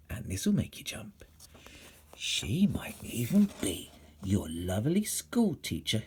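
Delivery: slow, secret whisper; conspiratorial whisper. A narrator's reading. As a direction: lean in and whisper it. Whispered scary aside to children.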